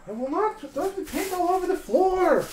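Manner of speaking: high, sing-song mock promise; mock obedience. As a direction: parrot the warning back, teasing. Mock-obedient reply to warning.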